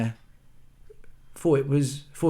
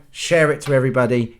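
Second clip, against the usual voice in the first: usual speaking voice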